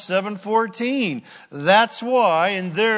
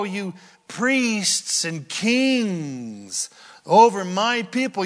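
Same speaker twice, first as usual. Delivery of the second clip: slow, raised proclaiming of the verse; scripture reading; 3 semitones higher, 4 dB louder than usual. Acted. Preacher reading Isaiah's words aloud, slow and proclaiming. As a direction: let God's words ring out.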